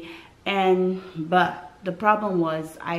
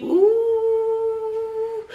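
high drawn-out sung ooh of pain; 14 semitones higher than usual. Retold or imitated. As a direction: moan it high as the pain comes. Labor pain returning.

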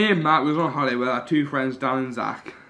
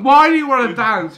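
incredulous; 10 semitones higher, 9 dB louder than usual. Retold or imitated. Incredulous retold question to Matt.

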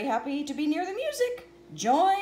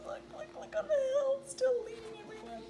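excited pointing; 10 semitones higher, 5 dB quieter, much faster than usual. Real, not acted. Excited about Poco on hill.

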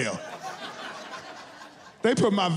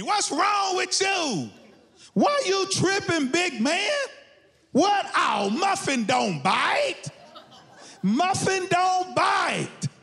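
high-pitched, drawn-out scoffing holler; indignant dog owner; 11 semitones higher, slower than usual. Acted. Dog owner defending pet to scared walker, high indignant holler. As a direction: stretch the words high, offended at the accusation.

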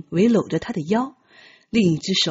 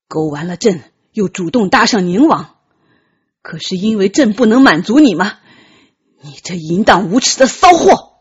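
angry accusation; 6 dB louder than usual. Acted. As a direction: interrogate him, rising to a loud insult at the end. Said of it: "责问道". (由低沉质问逐渐升高到愤怒大吼)

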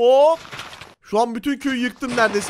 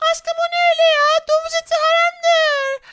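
put-on girly high spider girl voice; 4 dB louder than usual. (çok ince sesli örümcek kız)

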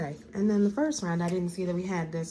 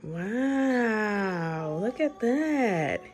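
slow, higher, amazed and admiring; 4 semitones higher, 4 dB louder, much slower than usual. Real, not acted. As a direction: marvel at it, drawing it out. Mom marvels at potion.

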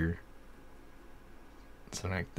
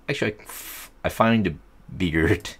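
normal talking voice

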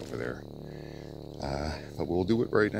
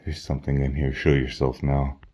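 calling out to spirit; 10 dB louder than usual. Real, not acted. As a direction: demand the spirit appear. Ghost hunter calls out spirit.

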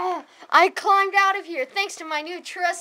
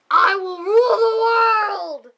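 megalomaniac rant; 3 semitones higher, 7 dB louder than usual. Acted. Villainous boast in ad.

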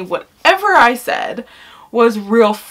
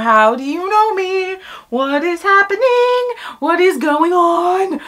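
high, stretched-out disbelief; high-pitched comic panic; mock bewilderment; 9 semitones higher than usual. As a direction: wail it in overdone shock. Acts out own stunned reaction.

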